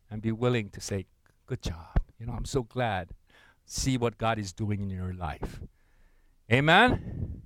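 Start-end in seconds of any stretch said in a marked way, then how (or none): 1.5-5.6 humble encouragement. Acted. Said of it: "lower yourself".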